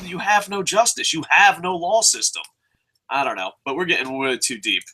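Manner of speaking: ordinary talk, nothing marked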